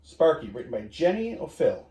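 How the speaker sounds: usual speaking voice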